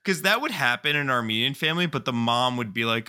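ordinary delivery

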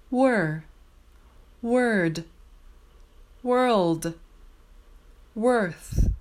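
Were, word, world, worth each said clearly with the r-vowel; careful r-vowel. Shown as a demonstration. Teacher modelling were, word, world, worth. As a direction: model the ER sounds one by one. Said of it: "I'll say each word in a row twice".

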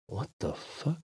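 hushed whisper to oneself. Acted. Walker to himself on seeing a stranger, whispered. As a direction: whisper it under your breath. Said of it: "I said to myself in a whisper".

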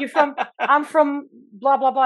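put-on British accent with trailing filler. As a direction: imitate a British man. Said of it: "this one British guy".

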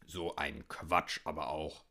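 put-on low, grumbling old hunter; Hüttemann. A narrator's reading. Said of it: "brummte der alte Hüttemann". (tief brummender alter Jäger)

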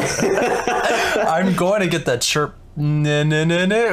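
slow words through laughter; laughing. Real, not acted. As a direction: talk while cracking up. Laughing over shared joke.

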